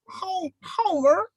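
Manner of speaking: high, drawn-out cartoon wife attempt; Marge Simpson. Stranger asked to do Marge, high slow attempt. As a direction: slowly strain up into a high cartoon voice. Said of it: "see if you can do Marge".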